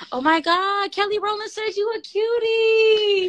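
high, excited starstruck announcement; thrilled squeal. Real, not acted. Host telling brother of [name]'s compliment, thrilled squeal. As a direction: squeal the news to him, thrilled.

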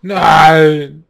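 loud anguished no; shout. Real, not acted. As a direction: yell it out in dismay. Dies in the game, cries out.

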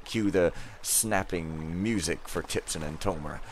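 usual speaking voice

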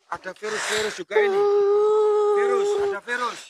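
high, tearful complaint; crying as you tell it. Real, not acted. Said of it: "Kenapa yang Anda menjadi kesedih". (sambil menangis, suara tinggi)

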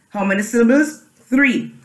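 usual speaking voice

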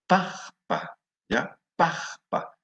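"pajpa" said high and slow, with a rasped j; teacher models "pajpa" for the class, slow and high; modelled Quechua word. Shown as a demonstration. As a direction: model "pajpa" slowly and clearly, pitch up. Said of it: "Maguey es".

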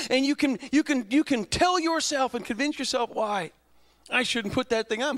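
high, loud preaching climb; impassioned pulpit build; preaching at full force. Acted. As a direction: drive the list of excuses up and up. Preacher listing excuses, rising.

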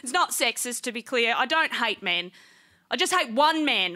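ordinary talk

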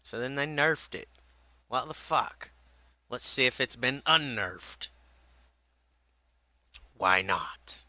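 usual speaking voice

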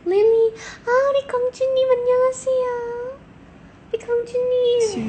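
high, loud, fast cooing. Real, not acted. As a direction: coo at the kitty princess. Cooing at the cat Lily.

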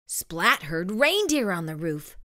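usual speaking voice

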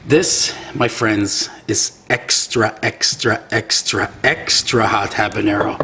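slow, weighty repeats of extra; slow dramatic build. Acted. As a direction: lean on every extra, very slowly. Presenting the hottest sauce.

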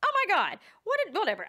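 high, loud, fast squealing outrage; high loud huffy squeal; mock outrage. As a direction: squeal your outrage at the kid. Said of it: "And I'm like".

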